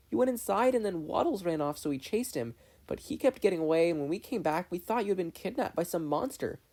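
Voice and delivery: put-on excited girl. Mabel telling Dipper what happened, high and excited.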